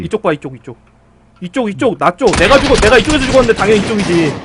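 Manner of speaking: frantic shouting. Real, not acted. Shouts directions to teammate.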